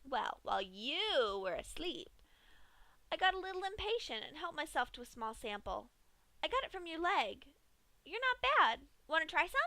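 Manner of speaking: high, sing-song cheerful pony voice; Pinkie voice, creepy cheer. Acted. Pinkie offers Dash a taste, high and cheery. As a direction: offer the horror like a party snack.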